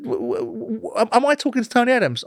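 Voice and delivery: high, stammering disbelief; retelling his stunned reply to Tony Adams, stammering. Retold or imitated. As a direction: stutter out the question in shock. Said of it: "I said".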